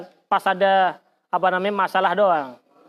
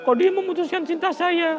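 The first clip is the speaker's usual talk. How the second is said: hurt whining quote; 9 semitones higher than usual. Retold or imitated. Voicing a heartbroken person.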